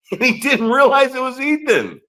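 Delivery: high, laughing punchline; real laughter lifting the voice high; amused laughing. Real, not acted. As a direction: squeal the line through laughter. Laughing at the story.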